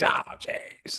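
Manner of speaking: hushed, voiceless exasperated cry; strained whispery outburst, put on; mock freak-out. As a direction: act out a freak-out under your breath. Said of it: "I didn't freak out and was like".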